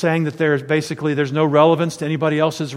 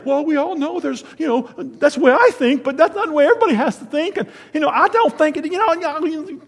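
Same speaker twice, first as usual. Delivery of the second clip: much higher, dithering hedge-everything preacher voice; mock wishy-washy preacher; 10 semitones higher than usual. Preacher mocking a timid answer on a television interview, in a high dithering voice. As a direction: mimic a spineless TV preacher who wriggles out of the question. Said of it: "I gave his accent".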